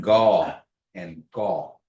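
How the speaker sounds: Gaw and gaw said very slowly, two onsets; contrasting sounds. Shown as a demonstration. Teacher asks class to hear the difference, slow. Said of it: "can you hear that".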